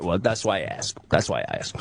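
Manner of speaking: low, soft mobster reply; imitating the pizza guy. Pizza guy backing down after the warning, soft low impression. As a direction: back off in a soft, low creep voice. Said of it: "he talks like this".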